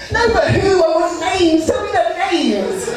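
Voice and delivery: put-on high, insistent girlfriend voice on the phone; nagging girlfriend, high and pushy. Girlfriend demanding names.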